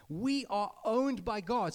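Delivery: ordinary delivery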